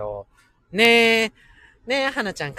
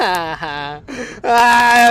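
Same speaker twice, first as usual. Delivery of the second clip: ordinary delivery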